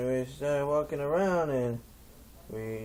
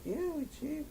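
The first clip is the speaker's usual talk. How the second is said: put-on squeaky girls' voice, far higher; 6 dB quieter than usual. Girls answer the stoner in a high squeak. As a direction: squeak it out like a giddy girl.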